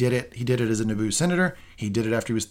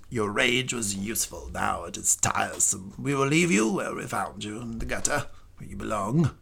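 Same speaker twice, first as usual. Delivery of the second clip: Grand Inquisitor voice; 4 dB quieter than usual. Retold or imitated. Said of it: "Kudos on the Grand Inquisitor accent".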